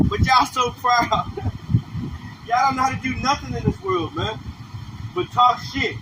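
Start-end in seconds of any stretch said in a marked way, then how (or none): 1.1-2.4 a quick airy laugh breaking through; breathy laugh. Real, not acted. Preacher laughing at his own jab at the crowd, breathy laugh. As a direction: laugh under the breath at the joke. Laughs after "y'all so proud.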